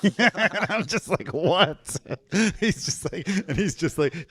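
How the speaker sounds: high-pitched, laughing retelling; amused disbelief, laughing through the words; laughing incredulously. Real, not acted. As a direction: crack up while telling the story. Laughing at friend's blunt remark.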